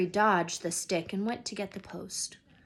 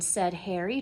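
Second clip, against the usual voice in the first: ordinary delivery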